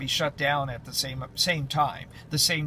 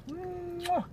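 kiss sound; 7 dB quieter than usual. Real, not acted. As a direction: plant a big kiss. Kissing the dog.

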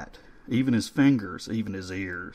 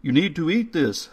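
put-on raised pitch, foreign-tinged elderly caretaker; a kindly old foreign gentleman, a bit louder; coaxing old man spooning soup; 7 semitones higher, 5 dB louder than usual. A narrator's reading. Old man's voice, held.

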